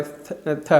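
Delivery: soft unvoiced "थ" on its own; th sound demo. Shown as a demonstration. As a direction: breathe out the "थ" sound twice. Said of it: "इसमें थ sound आ रहा है".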